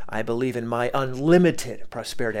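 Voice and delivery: "unlimited" stretched out with weight on it; stress on UNLIMITED. Shown as a demonstration. Coach demonstrating his affirmation to listeners, stressing "unlimited". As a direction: punch "unlimited" in the phrase. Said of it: "emphasis on different words".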